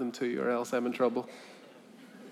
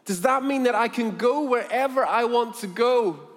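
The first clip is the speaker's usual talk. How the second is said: put-on high, loud, excited girl; overwhelmed amazement; 10 semitones higher, 7 dB louder than usual. Freed slave girl asks about leaving, thrilled and amazed. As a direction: let the wonder spill out in one breathless question. Girl's last astonished question.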